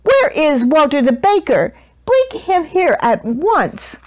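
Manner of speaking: high, indignant command; outraged roar. A narrator's reading. Duke sending for the baker, high indignant roar. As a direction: summon the culprit in a temper. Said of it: "roared the Duke".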